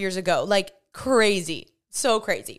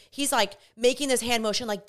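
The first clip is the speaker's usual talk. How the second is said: ordinary delivery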